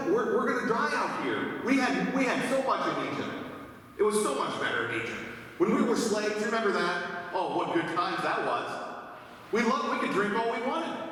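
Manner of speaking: mock the grumbling crowd pining for Egypt; whining Israelites. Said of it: "the people complained and said".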